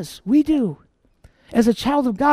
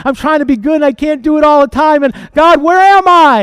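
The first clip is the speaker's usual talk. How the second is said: desperate cry; 4 semitones higher, 9 dB louder than usual. Acted. Desperate believer crying out to God.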